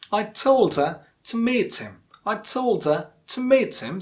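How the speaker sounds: TOLD and MEET long and strong, other words light; stress on TOLD and MEET. Shown as a demonstration. Teacher modelling sentence stress, TOLD and MEET prominent. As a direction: lean on told, then on meet. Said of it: "there are two stressed words".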